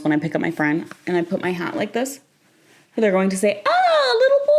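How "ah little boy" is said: very high, loud imitated exclamation; high-pitched mock delighted cry; mock teasing cry. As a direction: squeal it like a teasing friend. Voices friend's predicted teasing reaction.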